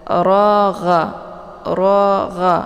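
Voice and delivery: ragha with the gha rasped in the upper throat like a snore; recited ragha with a guttural gha; throaty gha. Shown as a demonstration. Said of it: "seperti orang ngorok".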